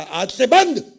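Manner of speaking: loud, high burst of resolve; emphatic declaration. Acted. Man to his wife after reading the newspaper, emphatic declaration. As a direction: announce a ban, sudden and forceful.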